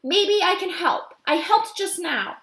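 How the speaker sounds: put-on high, slow, earnest child voice; Ruby argues she can help, earnest; earnest young girl. Ruby's child voice.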